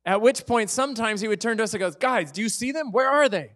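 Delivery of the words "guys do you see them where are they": put-on higher, louder puzzled old teacher; mock puzzled teacher. Teacher asking students where his glasses are, puzzled and loud. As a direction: ham up the baffled teacher.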